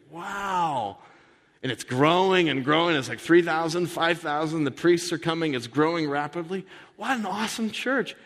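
high, enthusing about growth; gushing excitement. Acted. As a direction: pitch it like a thrilled convert. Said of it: "enthusing about growth".

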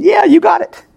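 high-pitched, eager retold reply; excited agreement. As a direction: jump up high in delighted agreement. Excited reply to dying father, retold.

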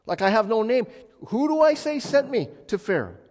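high, loud anxious pleading; flustered Moses. Retold or imitated. Moses asking God who sent him, high and loud. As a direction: ask God like you're out of your depth. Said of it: "when Moses says".

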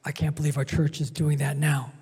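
low, quick complaining mutter; mock grumbler. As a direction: imitate a grumbling churchgoer under your breath.

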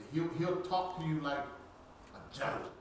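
ordinary talk, nothing marked

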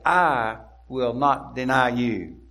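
"I" drawn out, loud and slow; emphatic I. Retold or imitated. Preacher quoting Peter's refusal to deny Jesus, emphatic "I". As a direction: punch "I" hard and hold it, then finish the vow. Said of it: "again emphatic".

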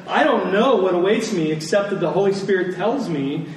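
usual speaking voice

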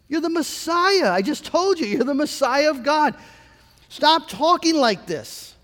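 high-pitched, pleading protest; Peter. Acted. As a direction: object in disbelief, pitched well up. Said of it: "not a surprise for Peter to say".

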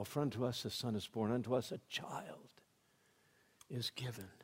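low, very soft quotation; quiet, subdued recital after the grand build; hushed correction. Shown as a demonstration. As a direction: drop to a gentle hush for the child. Corrects grand version with humble child.

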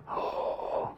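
breathy, voiceless mock yawn; hushed imitation of a yawn. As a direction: let out a soft, airy yawn. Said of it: "I yawned. I went".

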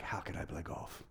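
hushed inner voice. Retold or imitated. Inner voice, tempted by golf.

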